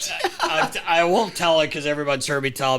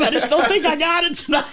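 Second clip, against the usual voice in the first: much higher, louder, laughing; laughing repeat of Phil; 9 dB louder than usual. Retold or imitated. Broadcaster repeating Phil's line while laughing. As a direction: crack up quoting it again.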